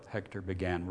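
plain reading voice

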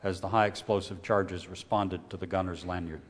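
plain reading voice

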